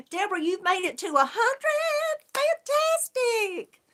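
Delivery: real excited whoop of congratulations. Real, not acted. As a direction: celebrate her milestone, stretching the last word way up high. Cheering a viewer's milestone of a hundred.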